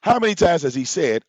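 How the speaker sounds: ordinary delivery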